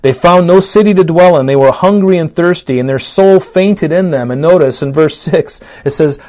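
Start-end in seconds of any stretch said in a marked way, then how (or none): 0.0-4.3 louder, higher preaching read of the psalm; fervent scripture reading. Acted. Preacher reads Psalm one oh seven to the church, fervent and loud. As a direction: proclaim the verses to the congregation.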